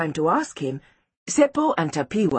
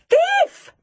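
very high, loud accusing shout; piercing child's yell; shrill cry; 6 dB louder than usual. A narrator's reading. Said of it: "they cried out".

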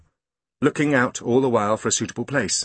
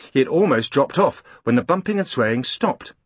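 plain reading voice

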